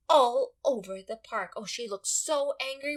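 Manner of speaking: normal talking voice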